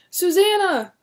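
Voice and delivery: high loud call across a crowd. Acted. William calling to his wife and daughter, loud call. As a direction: call their names over the crowd. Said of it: "William called".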